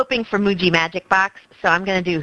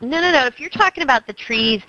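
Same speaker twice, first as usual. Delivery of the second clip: ordinary delivery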